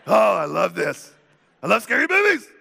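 loud, gushing thrill, climbing high; hammed-up giddy excitement; scary movie fan. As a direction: squeal it like a delighted horror fan. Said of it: "Every scary movie lover is like".

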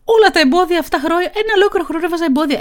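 high, loud self-mocking outburst; loud exclamation. Real, not acted. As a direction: burst out loud and high about your own excuses. Exclaiming over her own years of excuses. (δυνατό, ψιλό ξέσπασμα)

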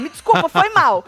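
loud, high mock apology; apology thrown out. Showing how Fu threw her apology, loud and careless. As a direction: toss the sorry at them with force. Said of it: "Ela jogou assim".

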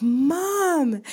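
high, loud breathy cry of protest; outraged daughter. Retold or imitated. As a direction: play the scandalized teen yelping Mom. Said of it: "she's getting all worked up".

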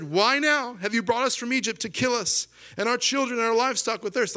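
high, loud mock outcry; hammed-up indignant grumbling; grumbling complaint. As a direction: wail it as an overblown accusation. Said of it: "They grumbled".

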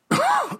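sudden sharp cough; coughing fit. Real, not acted. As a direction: cough as water goes down wrong. Choking on water.

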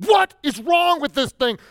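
mock exasperated shout at the phone; 1 frustrated yell. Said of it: "I'm going".

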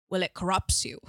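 put-on British accent with a laugh breaking through; light Michael Caine style British woman; laughing imitation of a British lady. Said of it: "It was like Michael Caine, but a woman".

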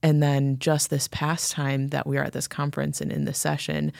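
normal talking voice

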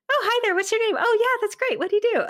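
high, chirpy polite hello; perky greeting. Retold or imitated. Greeting a guest at the studio, high chirpy hello. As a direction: brightly greet a stranger you don't recognise. Retells chirpy small talk.

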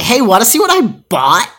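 high, loud excited offer; eager show-off. Retold or imitated. To the person who hit her, high excited showing off. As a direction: bubble over to show off the new toy. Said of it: "I was like".

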